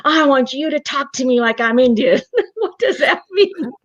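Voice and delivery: high, laughing through the demand; laughing at own prayer. Retold or imitated. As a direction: giggle as you replay the silly request. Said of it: "I literally said this".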